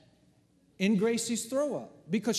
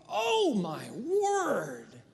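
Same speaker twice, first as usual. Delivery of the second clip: very slow, much higher mock shriek of disgust; startled exclamation; 8 semitones higher, much slower than usual. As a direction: drag it out like you just stepped in something. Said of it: "all of a sudden I hear Rochelle".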